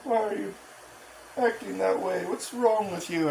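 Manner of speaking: high put-on voice, imitating onlookers; mock concerned onlooker. Family asking what's wrong with him, high mocking imitation.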